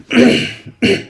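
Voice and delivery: a sharp, rough clearing of a sore throat. Real, not acted. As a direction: clear the hoarse throat before going on. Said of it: "थोड़ा गला ख़राब है".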